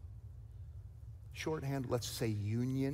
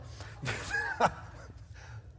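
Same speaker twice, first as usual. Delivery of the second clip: airy chuckle at own joke; self-amused laugh; breathy laugh. Real, not acted.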